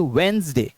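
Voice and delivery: वेडनेसडे said "wens-day", D silent; correct pronunciation. Shown as a demonstration. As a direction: squeeze वेडनेसडे into two syllables, wens-day. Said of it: "तो कैसे पढ़ेंगे".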